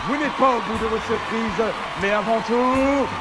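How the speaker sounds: loud, slow, high game-show host; TV host announcement. Acted. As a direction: open the show like a TV presenter.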